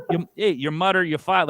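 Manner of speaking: Newfoundland accent with "mother" said as "mutter". Shown as a demonstration. Guest showing how Newfoundlanders really say it, Newfoundland accent. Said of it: "that's the way they use it".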